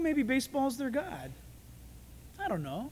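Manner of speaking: ordinary delivery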